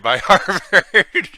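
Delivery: breaking into a high laugh. Real, not acted. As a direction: laugh through the last words, voice jumping up. Amused at praise from Harvard subject.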